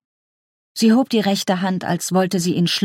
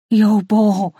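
pleading; 2 semitones higher, 3 dB louder than usual. Acted. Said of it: "flehte sie".

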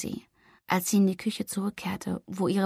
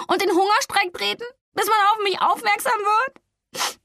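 much higher, tearful and strained; sniffling tears; 9 semitones higher, 3 dB louder than usual. Acted. Flori crying to her cousin about giving up, sniffling. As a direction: talk through sniffles. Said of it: "schniefte Flori".

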